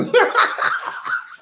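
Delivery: high, loud cackling laugh. Real, not acted. Laughs after whooping.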